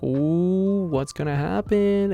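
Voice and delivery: slow, soft and curious; mock gossip thrill. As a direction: lean in, eager for the gossip. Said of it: "And you're like".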